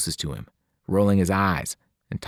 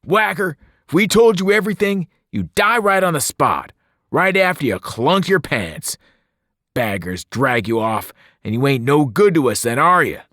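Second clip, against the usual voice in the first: much higher, louder barked order; angry shout; 7 semitones higher, 6 dB louder than usual. Acted. Albie shouts at the new boy to stop interrupting, furious.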